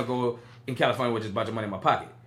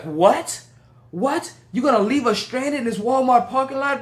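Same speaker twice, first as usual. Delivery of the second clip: disbelief; 8 semitones higher, 3 dB louder, slower than usual. Retold or imitated. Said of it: "And I was like".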